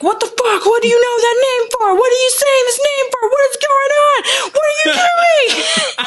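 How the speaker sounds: high, panicked mock yell; freaked-out Bruce. Batman losing it when he hears the name Martha, hammed-up panicked yell. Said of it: "Bruce is like".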